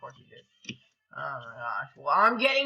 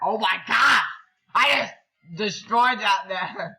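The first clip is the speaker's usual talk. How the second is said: high, loud, stunned exclamation; amazed outburst; 4 semitones higher, 8 dB louder than usual. Real, not acted. Gamer who destroyed a man, amazed outburst. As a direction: exclaim with stretched-out astonishment. Shocked by the rock's power.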